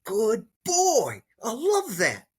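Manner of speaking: heartfelt, happy praise to the dog; genuine praise. Shown as a demonstration. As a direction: lift it with genuine delight for the dog. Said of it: "So this is praise".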